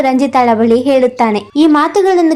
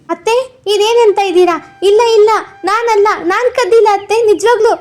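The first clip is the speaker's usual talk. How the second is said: much higher pleading protest; desperate denial; 7 semitones higher than usual. Acted. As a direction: beg to be believed, voice climbing. Bride accused of theft, denying. (ಅತ್ತೆಯ ಮುಂದೆ ಕಳ್ಳತನ ಮಾಡಿಲ್ಲವೆಂದು ಗಾಬರಿಯಿಂದ ಬೇಡುವ ಸೊಸೆ)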